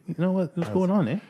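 mock Canadian small talk. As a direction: tack a lazy eh on the end. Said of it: "so natural just to say".

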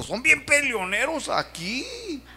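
high, slow put-on voice for the woman's complaint; imitating the woman. Pastor mimics the woman complaining about Guadalajara people, high and slow. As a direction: pitch it up and stretch it out, mimicking her.

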